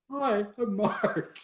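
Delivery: high, slow, awkward hello; starstruck greeting. Retold or imitated. Photographer meeting his idol, starstruck and slowed. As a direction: gush out your own name, overwhelmed.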